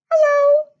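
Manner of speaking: very high, loud squeaky mouse; squeaky mouse hello. Acted. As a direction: sing out hello like tiny mice. Mice twins greeting.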